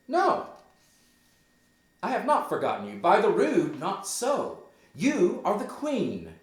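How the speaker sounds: high, loud acted defiance; defiant retort. Hamlet answers his mother back, loud and defiant.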